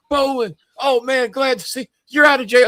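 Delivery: high, falsely cheery greeting; mock cheerful Adrian. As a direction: play the guilty friend acting glad. Imitating Adrian at car wash.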